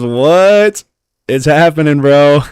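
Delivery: loud, high, elated shout; excited hype. Real, not acted. Streamer reads viewer's reaction to the drop, excited hype. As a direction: ride the hype at full volume. Reading chat after lucky drop.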